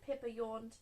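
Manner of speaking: ordinary delivery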